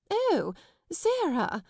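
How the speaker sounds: high, slow, strained surprise; forced exclamation. A narrator's reading. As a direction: headmistress faking a smile at Sarah. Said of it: "she exclaimed".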